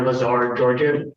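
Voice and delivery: ordinary talk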